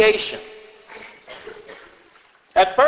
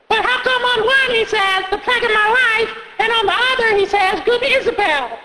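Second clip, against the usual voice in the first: put-on high kid voice picking at details; a sharp little kid arguing; quibbling child. Child arguing with the reader about the quotes, high kid voice.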